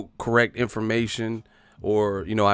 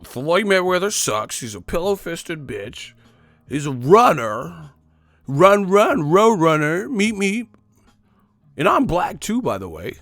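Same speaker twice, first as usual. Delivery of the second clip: high, loud mocking troll rant; high hammed-up troll yelling run, run; 5 semitones higher, 5 dB louder than usual. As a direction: ham up a fake fan taunting, going higher and louder. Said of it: "the type of shit they do".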